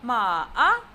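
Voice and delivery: "Ma a" sinking low, then rising loud; falling-rising tone on "Ma a"; third tone. Shown as a demonstration. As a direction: stretch "Ma a" down and up. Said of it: "with third tone".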